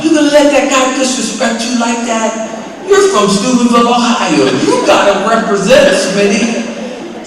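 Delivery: high-pitched, worked-up egging on; indignant goading. Retold or imitated. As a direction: goad a friend into defending his honor. Antagonist goads his friend, retold.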